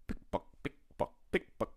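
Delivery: crisp voiceless clicks like high heels; clicking heels. Retold or imitated. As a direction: tap out each heel step. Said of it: "The lady's high heels go".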